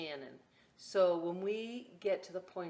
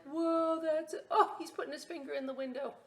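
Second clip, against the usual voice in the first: impressed pirates; 10 semitones higher, 6 dB louder than usual. Retold or imitated. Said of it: "all the pirates are like".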